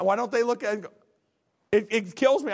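ordinary delivery

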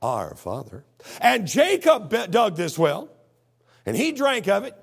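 loud, high challenge, drawn out in places; woman's challenge. Acted. Woman challenging Jesus over the well, loud challenge. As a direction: voice her challenge with big, punched-up volume. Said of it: "that's what she's saying".

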